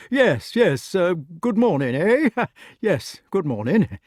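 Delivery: put-on high, loud, fussing old knight with a nervous laugh; an anxious host covering his nerves; flustered Sir Ector. A narrator's reading. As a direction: bluster through the greeting.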